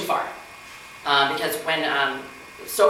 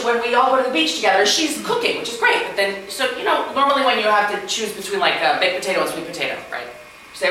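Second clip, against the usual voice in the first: ordinary talk, nothing marked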